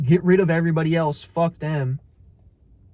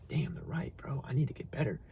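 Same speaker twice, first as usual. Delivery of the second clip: whispered, much softer inner voice; quiet realization; 6 semitones lower, 13 dB quieter than usual. Retold or imitated. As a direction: murmur it like a private admission. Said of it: "I'm like".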